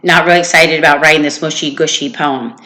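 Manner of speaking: lively storybook read-aloud to a class; reading a story aloud. Acted. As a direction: read it to the kids with expression. Teacher reads story to class.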